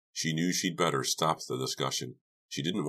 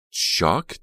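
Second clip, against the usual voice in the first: sharp, louder rising shriek; 4 semitones higher, 8 dB louder than usual. A narrator's reading. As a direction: shriek the name in alarm. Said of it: "Dina shrieked".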